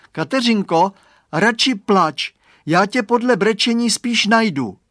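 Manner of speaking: put-on high, loud child call; boy calling. A narrator's reading. Boy calls to lost friend, high and loud.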